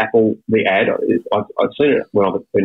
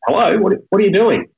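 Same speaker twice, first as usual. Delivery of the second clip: Michelle answering; 10 semitones higher than usual. Retold or imitated.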